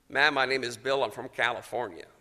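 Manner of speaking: ordinary talk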